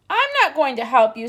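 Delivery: loud, fast screeching refusal; owl screech. A narrator's reading. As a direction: screech it down from the treetop. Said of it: "screeched the owl".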